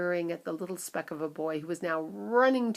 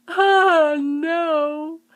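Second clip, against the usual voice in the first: very high, loud horrified cry; cried; 11 semitones higher, 12 dB louder than usual. A narrator's reading. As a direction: recoil in dismay. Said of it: "cried Missus Teavee".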